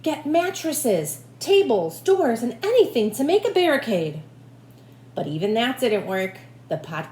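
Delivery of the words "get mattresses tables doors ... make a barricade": shouted orders. Acted. The mayor commands the townspeople to build a barricade, shouting. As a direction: bark out the list of barricade items. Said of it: "shouted the mayor".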